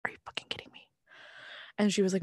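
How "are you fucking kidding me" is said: hushed unvoiced whisper of outrage; disbelieving whisper. To the barista who can't make Reserve drinks, whispered outrage. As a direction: hiss the disbelief under your breath.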